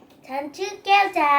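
slow, loud and clear for the toy; speaking to a toy. Real, not acted. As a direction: spell it out slowly for the parrot. Child speaks to talking parrot toy.

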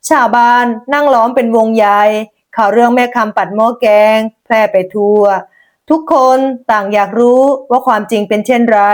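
usual speaking voice

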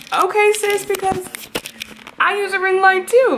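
high, sassy "okay sis"; sassy retort. Retold or imitated. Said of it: "And I'm like".

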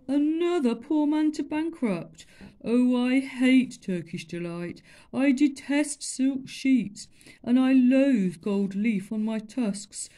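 wallow in misery; lamenting elephant. Acted.

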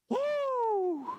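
a sudden high whoop of astonishment. Real, not acted. Preacher stunned by the verse lets out a high woo. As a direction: let out a high, wondering woo. Reacting to Jesus' hard verse.

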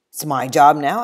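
loud, cocky retort; defiant claim. Acted. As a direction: grab it and taunt. Samuel grabs the pot.

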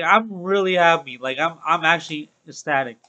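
usual speaking voice

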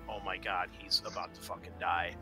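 ordinary talk

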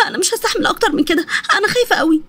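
high, frightened crying voice; acted panic of a woman in labour; tearful, nervous. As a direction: plead through nervous sobs. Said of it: "ونسرين بتوتر عيطت".

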